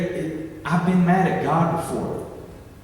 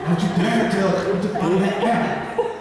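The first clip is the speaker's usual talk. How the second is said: laughing mock warning; 2 semitones higher than usual. Joking Adam and Eve quote.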